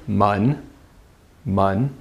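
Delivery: "Mun" rising from low; questioning upward "Mun"; rising second tone. Shown as a demonstration. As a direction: lift the pitch through each "Mun". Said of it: "Second tone, it means door".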